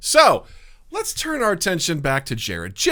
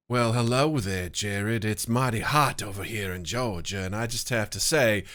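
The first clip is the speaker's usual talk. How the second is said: Southern belle sweet-talk, softer and lower; Southern belle accent; 5 semitones lower, 4 dB quieter than usual. Pretend. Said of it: "do a bunch of accents".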